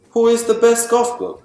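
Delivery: usual speaking voice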